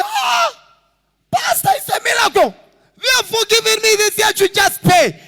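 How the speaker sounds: high, loud, fast burst of joy; overjoyed. Retold or imitated. Man overjoyed police forgave him.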